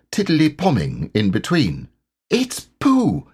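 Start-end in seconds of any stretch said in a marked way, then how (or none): 2.3-3.3 very high, excited exclamation; thrilled recognition, voice leaping up; Christopher Robin. A narrator's reading. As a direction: cry it out with delight. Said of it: "said Christopher Robin excitedly".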